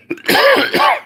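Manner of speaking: sudden hard cough from a dry throat; real dry-throat cough; coughing fit. Real, not acted. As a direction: cough harshly, choking a little. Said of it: "I'm choking up a little bit".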